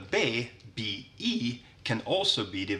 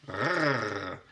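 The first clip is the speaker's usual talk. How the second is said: approving growl R. Shown as a demonstration. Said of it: "if you do, like".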